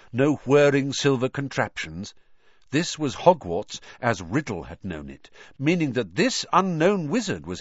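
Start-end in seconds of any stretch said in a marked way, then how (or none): none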